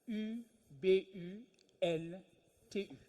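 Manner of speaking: Ubuntu spelled slowly, one letter at a time: U, B, U, N, T, U; spelling it out. Shown as a demonstration. To a lecture audience, spelling Ubuntu letter by letter. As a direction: spell out each letter of Ubuntu with a pause between. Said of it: "et ce mot est Ubuntu".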